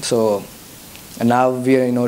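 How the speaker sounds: ordinary talk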